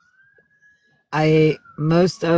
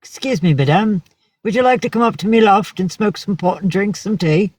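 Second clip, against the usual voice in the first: higher-pitched mock English accent; lilting imitation English accent, pitched up; English guy; 6 semitones higher than usual. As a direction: put on an Englishman making a polite invitation. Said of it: "English guy comes up and taps me".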